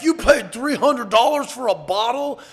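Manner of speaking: loud, high, slow incredulous question; retold disbelief. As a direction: mimic someone loudly stunned by the price. Retelling disbelief at his spending.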